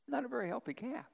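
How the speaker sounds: lifted high and hushed for the joke. Acted.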